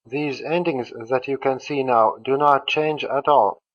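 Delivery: usual speaking voice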